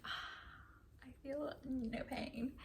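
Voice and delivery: airy, whispery sigh of relief, drawn out slowly; blissful relief. Retold or imitated. New mother retelling the epidural kicking in, softly relieved.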